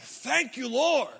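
loud, high mock praise; exultant thanks. Traveler spotting English airport signs, exultant thanks. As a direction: cry it out in hammed-up gratitude.

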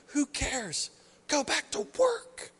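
high, slow dismissive voice; mock nihilist. Preacher mocks the nihilist view, high and dismissive. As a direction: sneer like it's all pointless.

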